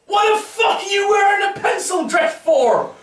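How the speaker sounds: high-pitched, indignant street yell; confrontational bloke shout. Retold or imitated. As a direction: holler it at a stranger in disbelief. Stranger confronts him over his dress.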